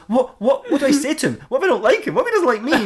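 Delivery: high, worried string of questions; hammed-up social panic, pitched high; anxious fretting. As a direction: fret like a nervous first date. Batman frets about meeting stranger.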